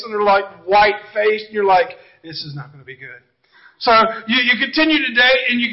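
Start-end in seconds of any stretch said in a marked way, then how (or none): 2.2-3.2 low, very soft foreboding; muted dread. Acted. Rider waiting in line, muted dread. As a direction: say it under your breath with dread.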